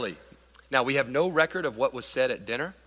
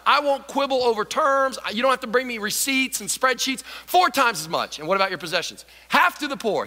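eager Zacchaeus; 7 semitones higher, 4 dB louder than usual. Acted. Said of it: "So he goes straight".

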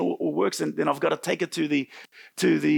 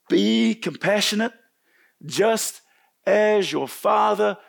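slow, higher, each phrase set apart; emphatic preaching; 4 semitones higher, slower than usual. Real, not acted. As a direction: proclaim it slowly, word by word. Preacher proclaiming a scripture line slowly.

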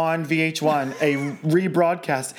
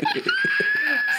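high screaming howl-laugh; howler monkey shriek. Pretend. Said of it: "You'd be like".

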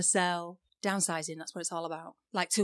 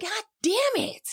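high-pitched, drawn-out mock cursing; exasperated outburst; much slower than usual. Driver fooled by an exit sign, hammed-up exasperation. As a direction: stretch it out in hammed-up frustration. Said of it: "it's so irritating".

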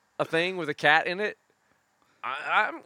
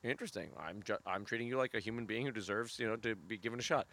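mock polite interest; 4 semitones lower, 13 dB quieter than usual. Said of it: "you're like".

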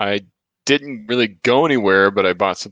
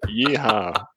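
yeehaw; 3 dB quieter than usual. Shown as a demonstration. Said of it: "echoing the bot's yeehaw".

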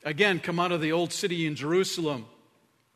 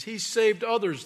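high, louder sneer; scoffing; 6 semitones higher, 5 dB louder than usual. Acted. Priests scoffing at Jesus on the cross, sneering. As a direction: jeer at the dying man. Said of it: "they scoffed".

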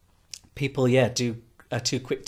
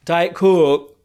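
loud, higher Yorkshire "Coke"; Yorkshire accent; 5 semitones higher, 10 dB louder than usual. Retold or imitated. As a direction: repeat it louder in broad Yorkshire. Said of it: "where I don't have to repeat myself".

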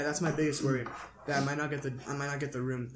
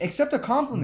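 higher, louder pleading; exasperated; 8 semitones higher, 4 dB louder than usual. Real, not acted. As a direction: push back, exasperated.

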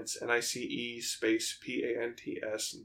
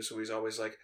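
normal talking voice

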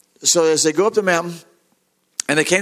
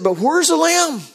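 much higher, drawn-out question; puzzled son; 12 semitones higher, slower than usual. Acted. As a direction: ask it high and slowly, as the son. Isaac questions his father.

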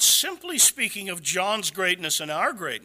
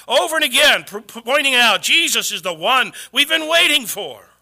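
louder, rising proclamation; fervent preaching; 4 semitones higher, 7 dB louder than usual. Acted. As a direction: preach it with growing fire. Preaching at full force.

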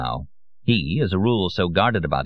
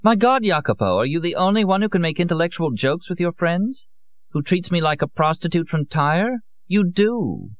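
high, mocking young woman's voice; a woman needling her lover; Lorenza, teasing; 11 semitones higher than usual. A narrator's reading. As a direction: toss the accusation back at him playfully. Lorenza's voice in the story.

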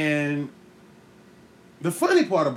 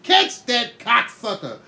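slow, raised shout of the movie line; mock movie shout; 5 semitones higher, slower than usual. Kid quoting his favourite movie, slow mock shout. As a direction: bark it like the movie hero.

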